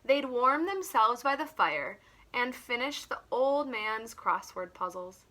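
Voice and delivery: ordinary talk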